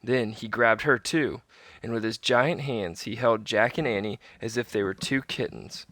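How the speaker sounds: usual speaking voice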